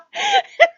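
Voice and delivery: squealed laugh, falling off; high shrieking laugh. Real, not acted. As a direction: let out a shrill laugh. Laughing hard at Loki story.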